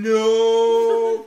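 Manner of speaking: mock wail. Acting waking at three a.m.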